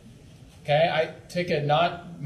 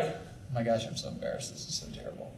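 mock embarrassed whisper, much softer; 7 semitones lower, 13 dB quieter than usual. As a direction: whisper it like a mortified counselor. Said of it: "not to be like".

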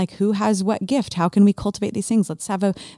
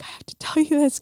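excited; 10 semitones higher than usual. Retold or imitated. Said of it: "I was like".

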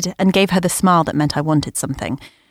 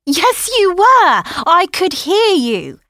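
high, loud, slow insistence; angry shout; 11 semitones higher, 4 dB louder, much slower than usual. Acted. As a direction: yell it back at her. Teen arguing with mother.

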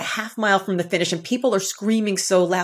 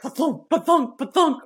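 "Pa-thunk" said higher, as bouncy clomping hoofbeats; heavy hoofbeat sounds; 7 semitones higher than usual. Retold or imitated. Runner mocking her own heavy stride, higher clomping pa-thunks. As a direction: clomp out "Pa-thunk" like a big horse running. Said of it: "I am like a Clydesdale".

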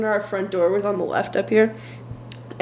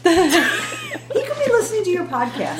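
high, slow, giggling tease; laughing tease; 13 semitones higher, slower than usual. Real, not acted. Teasing about the date hearing the podcast, high and laughing. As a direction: tease her through laughter.

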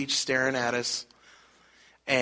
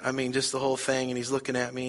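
normal talking voice

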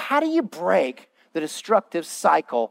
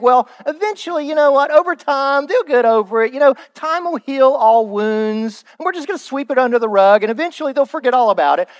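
self-justifying inner voice; 5 semitones higher, 4 dB louder than usual. Retold or imitated. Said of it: "And, and you think".

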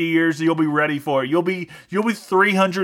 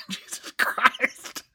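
laughing, breathless; 8 semitones higher, 7 dB quieter, much slower than usual. Real, not acted. Laughs at his own outburst.

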